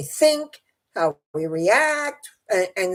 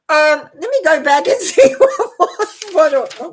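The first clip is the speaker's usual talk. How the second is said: higher, louder flustered laughter through the words; laughing; 8 semitones higher, 8 dB louder than usual. Real, not acted. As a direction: laugh at yourself for losing the thread. Laughs while losing her place.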